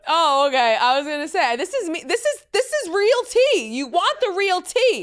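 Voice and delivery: loud, high, insistent comeback; defensive. Real, not acted. Host defends her episode to guest, defensive. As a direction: argue your case at full volume. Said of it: "Why are you being defensive?".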